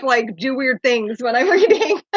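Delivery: normal talking voice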